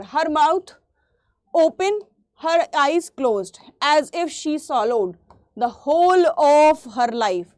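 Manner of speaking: poem lines read very slowly with pauses. Acted. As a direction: read it out slowly, savouring each phrase. Reads poem lines to class. (धीमे धीमे कविता पढ़ना)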